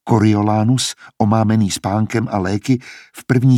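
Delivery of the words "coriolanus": plain reading voice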